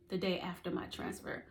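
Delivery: ordinary delivery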